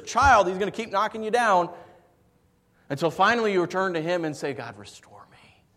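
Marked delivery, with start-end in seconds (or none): 4.5-5.6 a soft, hushed plea to God, fast fading; whispered prayer. Pretend. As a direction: whisper it like a broken man praying.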